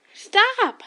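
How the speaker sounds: high, loud, breaking the silence with a shout; loud yell. Acted. You finally breaking your silence to end the argument, full shout. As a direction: burst out with everything you have. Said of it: "first time you heard you yell".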